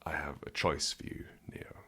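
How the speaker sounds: hushed Morpheus whisper. Acted. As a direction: breathe it like a secret.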